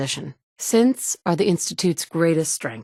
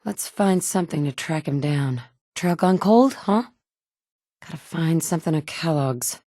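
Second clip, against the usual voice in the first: ordinary talk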